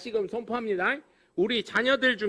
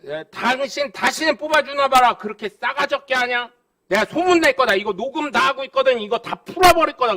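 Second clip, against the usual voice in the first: louder, heated threat, pushing faster in the middle; mock angry scolding; 4 semitones higher, 6 dB louder than usual. Voter threatening the councilman over the phone, louder scolding. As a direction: tell him off like an angry voter.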